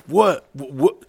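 caught off guard. Retold or imitated. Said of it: "you're just like".

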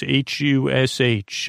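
normal talking voice

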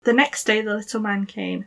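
plain reading voice

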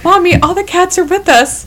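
put-on high-pitched kid's reply; imitated child. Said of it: "And they go".

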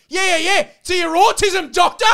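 loud, high-pitched jeering retort; angry mocking shout. Angry dog walker yelling back at the man on a call, mocking shout. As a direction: yell it over his protest with scorn.

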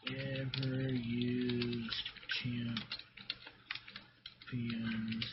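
very slow, quiet and stretched; drawn out. Acted. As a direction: drag each word out slowly. Drawing out words slowly.

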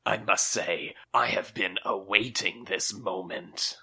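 sinister boogeyman, gloating. Acted. Boogeyman voice held.

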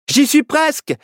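high, urgent call; strained high exclamation; crying out. A narrator's reading. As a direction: call out under pressure, high. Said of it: "s'écria Nao".

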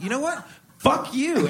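retelling a fight, shouting back in mock anger at a snobby editor; mock angry comeback. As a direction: hit back at the editor, pitched high.

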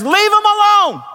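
very high, drawn-out thundering command; commanding voice of God. Acted. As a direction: cry it out as God defending someone. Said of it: "the voice of God Almighty".

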